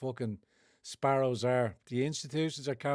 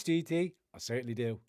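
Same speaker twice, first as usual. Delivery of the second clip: usual speaking voice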